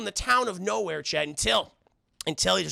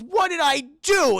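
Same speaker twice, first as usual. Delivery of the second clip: high, loud, drawn-out protest; slow hammed-up lament; aggrieved wail; 9 semitones higher, 7 dB louder, slower than usual. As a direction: imitate a wronged husband. Husband shut in attic.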